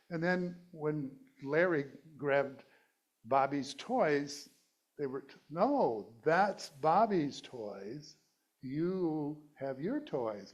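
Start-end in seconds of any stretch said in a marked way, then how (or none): 5.5-10.5 loud, very slow, drawn-out parental correction; scolding parent. Acted. Parent to Larry who grabbed Bobby's toys, slow mock scolding. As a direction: stretch it out firmly like correcting a toddler.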